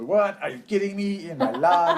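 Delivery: high, loud mock outrage, breaking into a laugh; imitating a cartoon vampire. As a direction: ham up the vampire's indignant cry. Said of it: "my son looks at me and goes".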